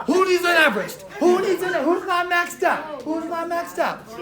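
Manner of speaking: loud, fired-up chanting. Retold or imitated. Imitating the sharp IT guy at midnight, fired up and shouting. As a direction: shout it like a hyped-up man imitated. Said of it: "he's, like, fired up".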